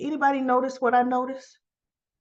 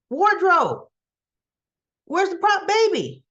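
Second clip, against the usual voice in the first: higher-pitched call across a set; 7 semitones higher, 3 dB louder than usual. Acted. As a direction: act out a demanding crew call. Acted crew calling out to wardrobe.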